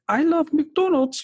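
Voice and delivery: put-on high, slow South African accent. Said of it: "in her little South African accent".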